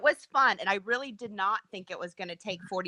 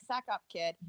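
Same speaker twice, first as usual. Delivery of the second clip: mock tough mutter; 2 semitones lower, 8 dB quieter than usual. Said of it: "I'm like".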